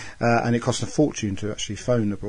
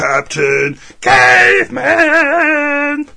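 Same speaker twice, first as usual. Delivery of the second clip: slow, much higher and louder, stretched-out "Caveman!"; cartoon hero shout; 9 dB louder, much slower than usual. Host guesses the caver's identity as a joke, big slow cartoon shout. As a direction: bellow it like a cartoon catchphrase.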